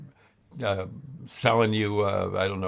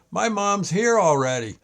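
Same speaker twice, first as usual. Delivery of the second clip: put-on kid calling out; 7 semitones higher than usual.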